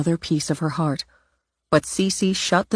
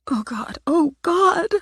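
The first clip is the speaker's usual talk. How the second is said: anguished cry; 12 semitones higher than usual. Acted. Simone crying out as the statue smashes, high anguished wail. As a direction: break as the statue shatters. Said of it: "Simone cried out in grief".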